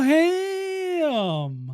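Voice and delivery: high, slow, drawn-out character voice; silly ham girl voice. Pretend. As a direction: stretch it out in a goofy high voice.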